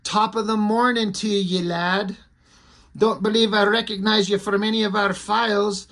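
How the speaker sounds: lilting mock Irish, lightly done; Irish accent. Reading Siren's comic dialogue, in a put-on Irish accent. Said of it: "throw in her Irish accent".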